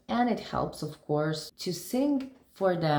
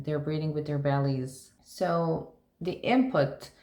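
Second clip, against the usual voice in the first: ordinary delivery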